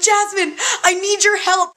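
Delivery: high, pleading call for help; desperate plea to her sister for help. Retold or imitated. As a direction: beg for rescue, half-laughing. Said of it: "I say it so desperately".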